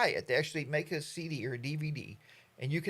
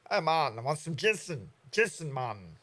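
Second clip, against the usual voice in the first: mock Jamaican. Tourist buying weed in Jamaica, lightly Jamaican. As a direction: ask for it like a tourist in on the joke.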